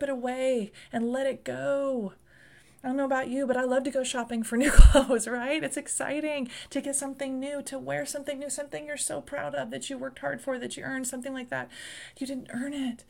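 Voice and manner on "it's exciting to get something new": ordinary delivery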